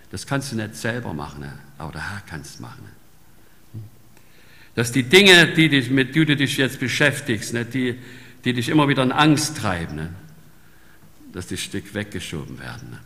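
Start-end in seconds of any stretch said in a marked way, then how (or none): 4.7-10.0 fervent sermon voice; forceful preaching. Acted. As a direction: preach it out to the congregation.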